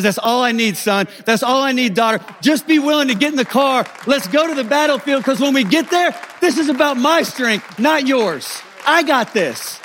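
high, emphatic divine assurance; fervent, driving sermon climax; impassioned preaching. Acted. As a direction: preach it with rising urgency as God. Preacher voices God, building to climax.

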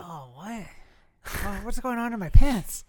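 mock aroused kid. Mocking a kid's arousal.

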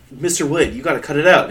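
put-on an exasperated girl yelling at her dummy; loud scolding. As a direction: tell the dummy off loudly.

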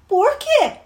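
put-on high-pitched childish question; pestering little girl. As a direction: needle big sister like a nagging kid. Little girl pesters older sister.

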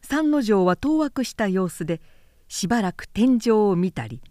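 usual speaking voice

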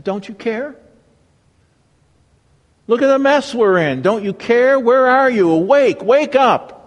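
rising, pleading cry of alarm; desperate plea. Retold or imitated. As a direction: call out to a sleeping God in panic. Psalmist's cry acted out.

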